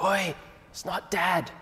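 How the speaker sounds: high, slow whisper-yell. Retold or imitated. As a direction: hiss it urgently to a brother. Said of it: "that whisper scream thing that kids try to do".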